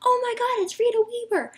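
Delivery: high-pitched re-enacted fangirl freak-out. Fan spotting a gymnast's mom outside the arena, freaking out. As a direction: squeal it like a starstruck fan. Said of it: "I'm, like, freaking out".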